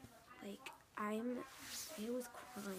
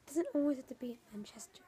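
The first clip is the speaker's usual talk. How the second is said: louder, fast, pressing hard on doesnt; loud insistence; 4 semitones higher, 9 dB louder, faster than usual. Real, not acted. Kid vlogger warning viewers, loud and fast. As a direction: push the point out to the viewers. Loud line then whispered repeat.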